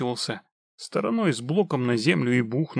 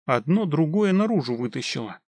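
usual speaking voice

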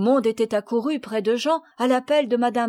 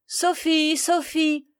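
put-on higher-pitched call for a lost child; raised, searching call; 6 semitones higher, slower than usual. A narrator's reading. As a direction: call her name out into the forest. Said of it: "criait-on".

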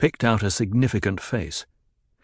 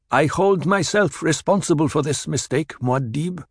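lightly voiced lieutenant, higher; contrite lieutenant; 7 semitones higher than usual. A narrator's reading. Korba confessing to Paul, lightly voiced contrition. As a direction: take the blame soberly.

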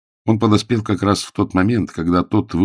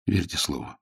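firm; 9 dB quieter than usual. Acted. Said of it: "отвечал Загорский решительно".

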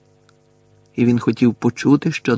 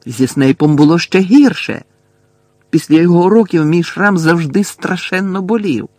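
loud, raised voice; agitated complaint, higher and louder; heated protest; 7 semitones higher, 7 dB louder than usual. A narrator's reading. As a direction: argue back forcefully. Harry arguing back in the quarrel.

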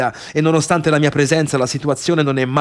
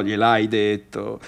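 normal talking voice

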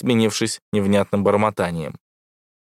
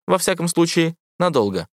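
высокий ровный голос ИИ; говори как сладкий искусственный интеллект; голос ИИ Эвредики; 8 semitones higher than usual. A narrator's reading. (высокий голос ИИ)

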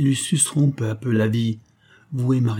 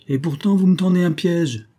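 higher-pitched, heated accusation; agitated accusation; 7 semitones higher than usual. A narrator's reading. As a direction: accuse him, voice jumping up. (accusation agitée, voix aiguë)

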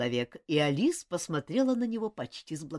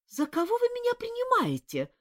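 much higher shocked cry; outraged disbelief; indignant exclamation. A narrator's reading. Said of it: "воскликнула Алисия".

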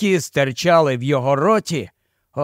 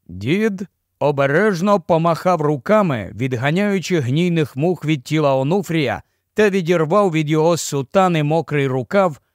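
ordinary delivery, nothing marked

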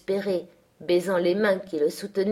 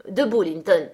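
sharp, louder order, rising in pitch; raised-voice imperious call; shouted command; 5 dB louder than usual. A narrator's reading. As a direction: order him up, louder on the repeat. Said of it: "cria-t-il".

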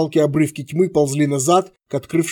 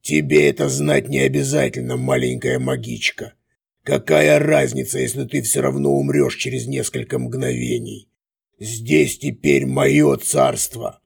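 sneering villain. A narrator's reading. Villain monologue.

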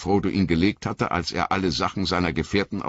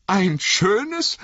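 indignant Sam; 4 dB louder than usual. Acted.

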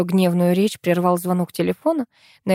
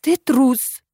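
higher, louder indignant accusation; 7 semitones higher, 5 dB louder than usual. A narrator's reading. Administrator accusing Vasya of cowardice, indignant. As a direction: throw it out in indignation. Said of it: "возмутилась Наина Львовна".

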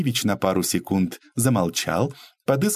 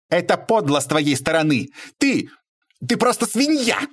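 high-pitched furious squeal; shrill outcry; 9 semitones higher than usual. A narrator's reading. Lady losing all her polish at Bobrov, shrill outcry. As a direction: screech the insult, pitch climbing. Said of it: "визгливо вскричала дама".

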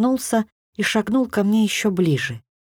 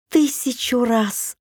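joyful; 3 semitones higher, much slower than usual. A narrator's reading. Героиня радостно соглашается.

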